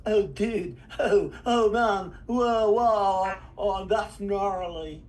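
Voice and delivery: put-on stretched-out surfer dude accent; exaggerated California surfer. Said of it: "when they think of California".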